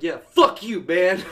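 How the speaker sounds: loud, punched comeback; mock defiant yell. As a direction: fire it back loudly like a trash-talker. Acts out gamer cussing out opponents.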